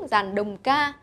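usual speaking voice

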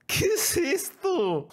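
very high, drawn-out disbelieving cry; slow, high-pitched mock-crying question; baffled wail. Real, not acted. Baffled wail at a bad video.

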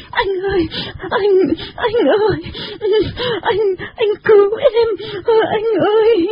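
high, wailing plea for help; loud wailing. A narrator's reading. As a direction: bawl in a high, helpless little voice. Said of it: "Thế là chị ta bù lu bù loa". (giọng cao, khóc bù lu bù loa)

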